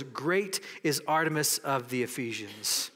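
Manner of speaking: ordinary delivery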